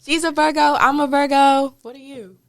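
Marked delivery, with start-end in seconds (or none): none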